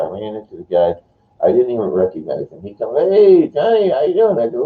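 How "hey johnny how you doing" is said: put-on high, raised hail to an old acquaintance; acted hearty greeting. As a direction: call out a surprised hello, pitched up. Acts out old acquaintance greeting him.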